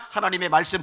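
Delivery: normal talking voice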